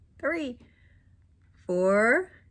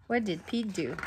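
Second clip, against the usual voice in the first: ordinary delivery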